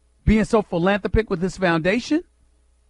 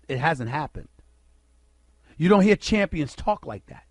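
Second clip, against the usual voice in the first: usual speaking voice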